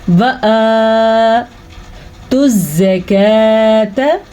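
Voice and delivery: slow tajweed chant with drawn-out long vowels; Quran recitation. Shown as a demonstration. Teacher modelling the verse for students, slow tajweed recitation. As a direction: recite word by word, holding each madd.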